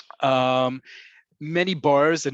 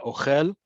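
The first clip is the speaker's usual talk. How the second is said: "ochel" stressed on the end, o-KHEL; second-syllable stress. Shown as a demonstration. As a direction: put the weight on the last syllable of "ochel". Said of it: "The second word is a verb".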